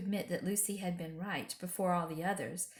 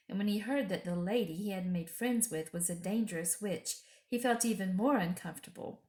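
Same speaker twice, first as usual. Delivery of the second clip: ordinary delivery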